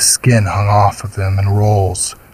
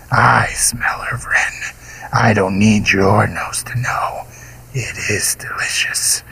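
monster whisper; 3 dB quieter than usual. A narrator's reading. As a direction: whisper like a beast savoring prey. Golgoroth whispering his claim.

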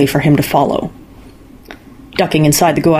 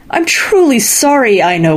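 mild wail; 6 semitones higher than usual. A narrator's reading. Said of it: "wailed Youkahainen".

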